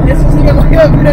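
high, loud thrilled cry; excited squeal. Real, not acted. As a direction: shriek with pure thrill. Thrilled passenger during fast acceleration.

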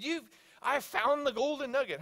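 high, excited announcement; pumped. Retold or imitated. Coworker announcing his find in Scripture, pumped. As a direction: announce a thrilling discovery. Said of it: "He was so pumped".

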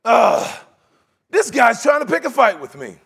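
loud, exasperated outburst; king going nuts. Acted. Preacher acting the king reading the letter, going nuts. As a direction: blow up like an offended king. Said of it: "the king of Israel just goes nuts".

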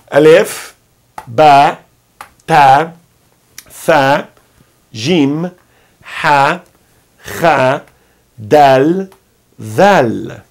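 clear, spaced recitation of Alef, ba, ta; call and repeat. Shown as a demonstration. Teacher leads students repeating the Arabic alphabet. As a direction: recite each letter for the class to echo. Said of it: "Me acompanha. Eu falo daqui, você daí".

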